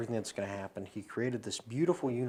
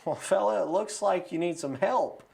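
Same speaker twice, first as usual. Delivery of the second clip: higher, louder, folksy call to a swimmer; passing boater; 6 semitones higher, 4 dB louder than usual. Retold or imitated. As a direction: hail someone in the water, offering help. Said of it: "Well, the boat goes".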